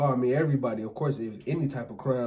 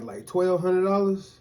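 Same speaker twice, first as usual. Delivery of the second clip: slow, loud and higher, stretching the amount; drawn out for emphasis; 8 semitones higher, 9 dB louder, slower than usual. Real, not acted. Friend marveling at her casino winnings, slow and emphatic. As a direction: savor the big number.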